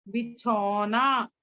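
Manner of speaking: बिछौना said slowly as the answer; slow reveal. Shown as a demonstration. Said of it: "तो क्या बन जाएगा?". (बिछौना धीरे से बताना)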